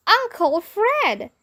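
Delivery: high, slow announcer call; announcing. A narrator's reading. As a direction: draw out the name like a host. Host announcing star.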